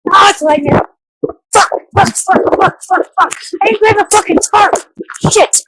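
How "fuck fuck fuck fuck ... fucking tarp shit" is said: high, frantic string of curses; mad swearing. Real, not acted. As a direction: curse in a high voice while scrambling in the rain. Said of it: "I'm so mad right now".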